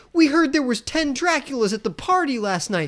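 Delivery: high-pitched mocking interrogation; taunting impression of a busybody tough guy; mock accusing bully. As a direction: pitch it up, needling him with the accusation. Acting out guys accusing Eric.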